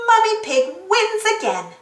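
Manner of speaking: plain reading voice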